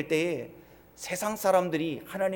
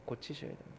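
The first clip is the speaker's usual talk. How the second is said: whisper; 5 semitones lower, 14 dB quieter than usual. Real, not acted. Pastor drops to whisper.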